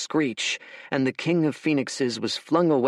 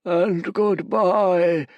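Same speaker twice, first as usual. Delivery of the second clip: slow, loud, higher creature croak; 8 semitones higher, 5 dB louder, much slower than usual. Acted. Horus's head bids Fate farewell, slow croak. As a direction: drag it out like a dying monster. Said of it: "croaked the head of Horus".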